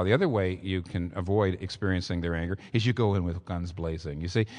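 ordinary talk

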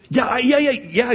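put-on high, eager kid butting in; eager child. Child eagerly interrupting his father.

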